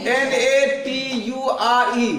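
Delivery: slow letter-by-letter spelling; slow letter-by-letter spelling for students. Shown as a demonstration. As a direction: spell each letter slowly. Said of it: "ये क्या लिखा हुआ है?".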